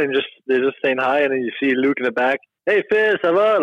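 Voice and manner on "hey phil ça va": bright high "Hey, Phil, ça va?"; imitating Luc. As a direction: greet him in French like a cheerful GM. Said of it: "Like, in French".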